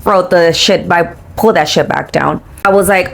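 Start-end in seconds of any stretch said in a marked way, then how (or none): none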